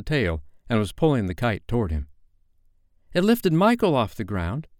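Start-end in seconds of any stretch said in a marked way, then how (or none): 3.1-4.7 high-pitched, eager exclamation; crying out. A narrator's reading. Peter seizing on the kite as escape, crying out. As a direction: cry out with a sudden idea. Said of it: "he cried".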